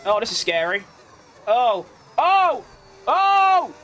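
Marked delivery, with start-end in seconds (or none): 1.5-3.7 rising frightened yelps, louder each time; sharp scared cries climbing higher and louder; startled yelps. Real, not acted. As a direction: yelp in real fright at a near crash. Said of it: "That's horrifying!".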